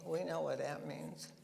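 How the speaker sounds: ordinary talk, nothing marked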